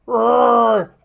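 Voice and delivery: loud short roar; one last roar; dragon roar. Acted.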